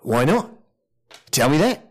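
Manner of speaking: high, protesting character voice; wheedling visitor protesting. Acted. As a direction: pitch climbing into quick pleading.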